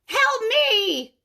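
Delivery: high, loud, put-on giantess cry; roaring giantess. A narrator's reading. Giantess begging Kate for help, high loud cry. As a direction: wail like a huge desperate woman. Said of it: "the huge woman roared".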